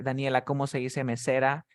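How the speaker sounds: ordinary talk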